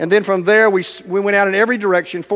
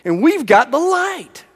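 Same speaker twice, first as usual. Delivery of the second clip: rousing call; 6 semitones higher than usual. Real, not acted. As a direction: lift them up with the closing charge. Preacher's rousing close.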